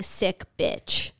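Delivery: ordinary talk, nothing marked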